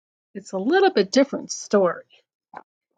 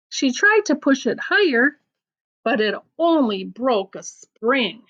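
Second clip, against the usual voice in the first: lively storybook reading with voiced bits; picture-book read-aloud; reading to children; 3 semitones higher than usual. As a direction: read the silly poem to a child. Reads a funny rhyme book aloud.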